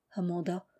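ordinary delivery

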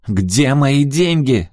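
high, loud, drawn-out yell. A narrator's reading. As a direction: scream the demand slowly and loudly. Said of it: "заорала она".

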